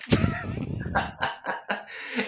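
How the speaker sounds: very high, helpless laughter; real laugh that won't stop; long high laugh. Real, not acted. Host laughing hard.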